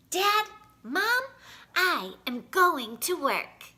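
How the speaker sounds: loud, slow and proud; proud announcement. A narrator's reading. A boy waking his parents to announce work, proud. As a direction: declare it to the sleeping parents. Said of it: "Junior exclaimed again".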